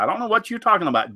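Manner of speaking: ordinary talk, nothing marked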